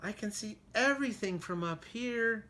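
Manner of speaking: small high mouse voice. Acted. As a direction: marvel like a tiny mouse. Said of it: "what she thought was".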